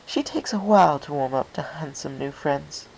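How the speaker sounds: smooth over a shy friend, a touch genteel; lightly voiced Rarity.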